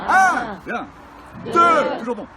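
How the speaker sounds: loud count for the crowd; counting aloud. Real, not acted. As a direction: build the count up loudly. Leads the crowd counting to three.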